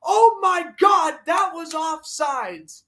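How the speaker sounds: high, slow, outraged shout; a drawn-out, high-pitched furious yell; angry shout. Real, not acted. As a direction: bellow at the referees in disbelief. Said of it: "now I'm gonna get mad".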